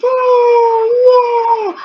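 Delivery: high, loud cheering shout; excited cheer. Acted. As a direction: cheer the kids on after counting. Cheering after counting to ten.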